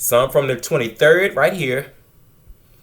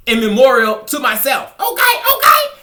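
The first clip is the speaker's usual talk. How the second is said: playful exclaim; 8 semitones higher, 5 dB louder than usual. Real, not acted. Joking grandly about her plant.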